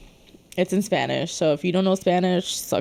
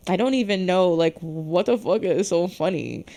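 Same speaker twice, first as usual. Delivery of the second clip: ordinary delivery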